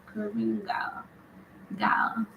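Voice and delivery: soft, tentative Caribbean accent; Caribbean accent attempt. Shown as a demonstration. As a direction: test out a Caribbean accent under your breath. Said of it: "I can't do the accent".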